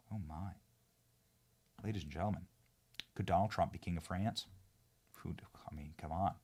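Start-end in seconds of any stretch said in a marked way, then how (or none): 5.2-6.3 breathy conspiratorial whisper. Real, not acted. Host teasing viewers about a French king, whisper. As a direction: lean in and whisper the aside.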